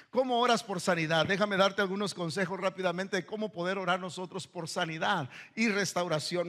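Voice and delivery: usual speaking voice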